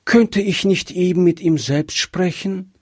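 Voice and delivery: put-on young child asking politely; little girl voice. A narrator's reading. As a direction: speak up as a shy little girl. Gretchen asks again.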